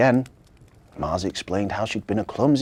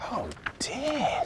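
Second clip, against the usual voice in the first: sigh; 11 semitones higher, 5 dB quieter than usual. Acted. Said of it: "sighed Victoria".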